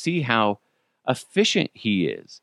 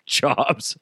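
usual speaking voice

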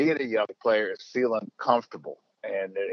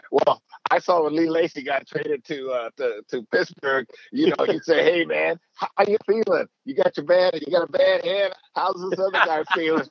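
imitating Mike, mocking; 7 semitones higher than usual. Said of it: "He goes".

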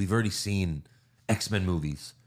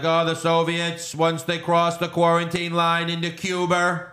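JFK impression; 6 semitones higher, 3 dB louder than usual. Host mimicking JFK announcing the blockade, a higher put-on voice. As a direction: stretch it out like a president on TV. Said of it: "he's like".

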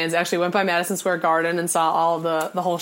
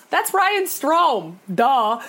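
high, slow, smug answer; sassy duh; 5 semitones higher, much slower than usual. Retold or imitated. Answering Harry's quiz, high and slow with smug sass. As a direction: drag out the obvious answer.

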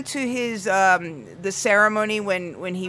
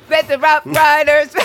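laughing; 7 semitones higher, 6 dB louder, slower than usual. Real, not acted. Laughing through [name] lyric.

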